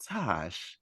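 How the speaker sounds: light, angelic call, softly; Leslie. Retold or imitated. Friend calling Lutasha by nickname, light and angelic. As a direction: say it light, airy and drawn gently. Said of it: "her voice is just very light and angelic".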